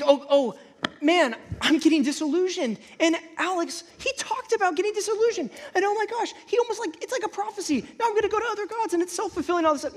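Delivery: high, fast, panicky self-talk; mock panic. Worried believer spiraling over a prophecy, mock panic.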